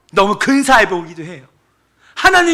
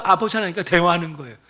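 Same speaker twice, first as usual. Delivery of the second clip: ordinary delivery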